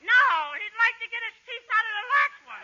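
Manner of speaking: put-on high kid wisecrack; bratty little boy. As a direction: pipe up like a cheeky child. Costello plays little brother.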